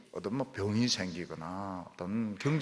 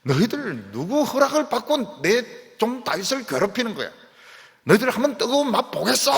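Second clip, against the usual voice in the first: higher, louder menacing threat; God's threatening voice; 9 semitones higher, 7 dB louder than usual. Acted. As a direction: warn David's tormentors they'll pay. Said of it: "이런 소리를". (다윗을 괴롭히는 자들에게 높고 크게 위협하는 하나님)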